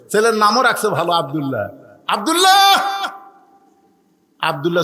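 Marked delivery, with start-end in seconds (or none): 2.1-2.6 calling out. Acted. Father calling son at night.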